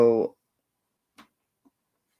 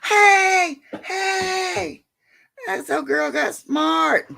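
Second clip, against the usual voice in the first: high, excited calls to the dog; high-pitched delighted hey; excited praise; 13 semitones higher, much slower than usual. Real, not acted. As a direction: cheer the clever dog on.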